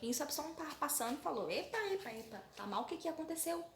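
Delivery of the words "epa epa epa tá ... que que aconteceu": high startled "epa" to a crying sister; surprised. Acted. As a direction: catch yourself, alarmed. Absalão encontra Tamar chorando. (irmão surpreso vendo Tamar chorar, voz aguda)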